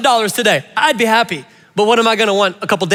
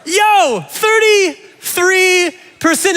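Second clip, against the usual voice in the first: much higher, very slow amazed repeat; disbelief; 9 semitones higher, much slower than usual. Real, not acted. Pastor repeating the poll result in amazed disbelief, higher and slow. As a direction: stretch it out in astonishment.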